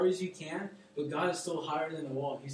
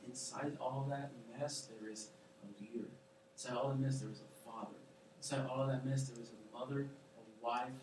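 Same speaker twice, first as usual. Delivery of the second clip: tender voice; 5 semitones lower, 7 dB quieter than usual. Retold or imitated. God speaking tenderly to listener.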